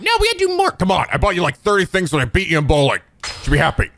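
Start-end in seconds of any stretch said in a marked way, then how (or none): 0.0-0.7 mock little-girl falsetto; Haruka. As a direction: imitate the girl in very high falsetto. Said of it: "you're like".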